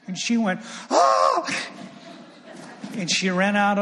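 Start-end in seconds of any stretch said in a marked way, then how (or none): 0.9-1.5 sudden high, loud shrieked gasp. Retold or imitated. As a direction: let out a startled movie-style shriek. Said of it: "It was like a shriek".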